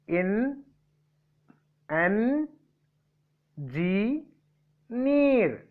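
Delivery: "N, G, near" split by long pauses, high on "near"; syllable by syllable. Shown as a demonstration. Teacher splitting a trisyllabic word, "N, G, near" in separate beats. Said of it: "trisyllabic word".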